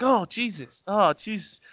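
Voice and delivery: a touch of fright; nervous mutter. Retold or imitated. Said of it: "And I was like".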